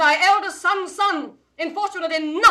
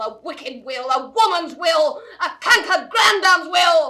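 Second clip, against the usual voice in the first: building to a laughing, scornful shout; each will louder and more scornful; mocking shout; 3 dB louder than usual. Mother jeering at the grandmother, rising to a shout.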